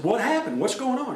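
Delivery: retold startled questions, pitched high; alarmed questioning. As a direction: demand answers in acted shock. Shocked principal questions the teacher, retold.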